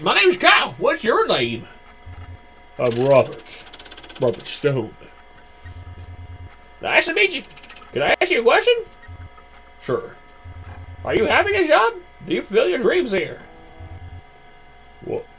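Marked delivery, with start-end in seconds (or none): 0.0-1.7 high put-on customer voice. Customer voice in game. 6.8-8.7 high put-on customer voice. Customer voice in game. 11.0-13.4 high put-on customer voice. Customer voice in game.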